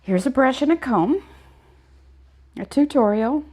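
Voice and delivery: hoger, iets luider, droog spottend; sarcastisch advies. Retold or imitated. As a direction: geef de borstel met een knipoog. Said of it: "and I'm like".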